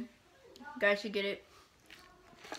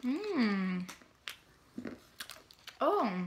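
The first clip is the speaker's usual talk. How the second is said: talking between bites; chewing; 3 semitones higher, 3 dB quieter than usual. Real, not acted. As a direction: mouth partly full of peanuts. Seen 0: Peanut put in mouth at 2.2, chewing visible through 7.3.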